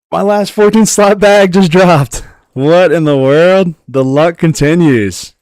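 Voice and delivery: loud, high-pitched, drawn-out astonishment; excited disbelief. Real, not acted. Streamer gets a lucky bag drop, excited shouting. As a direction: exclaim over an unbelievable stroke of luck. Rare bag drops during game.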